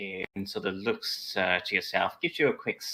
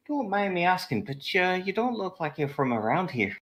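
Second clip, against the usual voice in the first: higher, curious tavern barmaid; 11 semitones higher than usual. Acted. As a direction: probe the stranger gently, pitched up. Barmaid voiced in scene.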